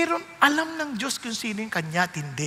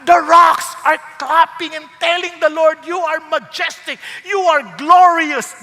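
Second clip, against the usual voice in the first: loud, ringing praise of the rocks; swelling awestruck proclamation; excited praise; 6 semitones higher, 10 dB louder, slower than usual. Acted. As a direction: proclaim it like creation shouting glory. Rocks praising God, imagined.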